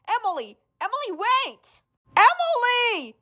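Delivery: calling out. Acted. Calling after fleeing girl.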